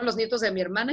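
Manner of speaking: usual speaking voice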